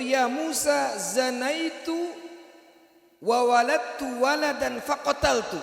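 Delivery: high, loud anguished confession; ashamed woman confessing to Musa; woman's confession. Acted. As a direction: cry out the sin in Arabic.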